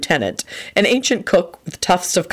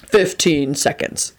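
ordinary delivery, nothing marked

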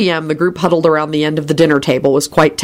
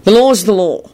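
sternly intoned pronouncement, pitch raised; 5 semitones higher, 3 dB louder than usual. A narrator's reading. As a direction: lay down the law, mildly stern. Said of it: "Kingsley intoned sternly".

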